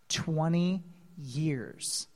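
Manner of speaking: low, slow, soft and weighty; hushed reveal. Real, not acted. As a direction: let it land slowly and quietly. Answers own question, then repeats.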